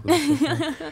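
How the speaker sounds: high, giddy mock delirium; dazed high quote. Friend retelling her fainting, high giddy voice. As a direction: squeak it out like you're loopy.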